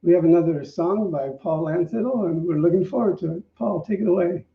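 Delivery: normal talking voice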